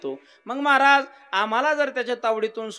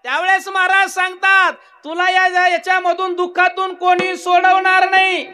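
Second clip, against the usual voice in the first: high, loud preaching; fiery preaching; 10 semitones higher, 10 dB louder than usual. Real, not acted. Preacher warns the crowd no one will save them, loud. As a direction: thunder the lesson at the crowd.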